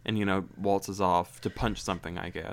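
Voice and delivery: usual speaking voice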